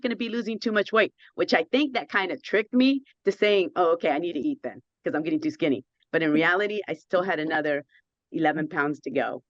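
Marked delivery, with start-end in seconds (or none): none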